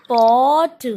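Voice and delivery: pot stretched loud with open 'aw'; correct pronunciation. Shown as a demonstration. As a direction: hold the vowel in pot, then release t. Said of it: "आवाज़ निकालेंगे तो ओ". (pot का सही उच्चारण, 'ऑ' खींचकर)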